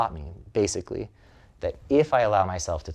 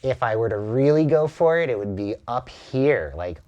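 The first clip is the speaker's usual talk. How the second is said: pushed up into the nose, louder; nasal high voice; 4 semitones higher, 6 dB louder than usual. Shown as a demonstration. Asked to lay the nasal tone on thick, louder. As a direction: place it all the way up front. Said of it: "lay it on thick for a moment".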